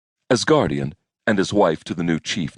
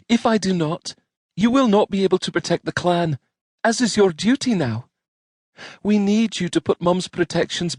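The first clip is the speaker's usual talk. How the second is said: put-on earnest young woman voice; 13 semitones higher than usual. Jeanette pleading with cousin.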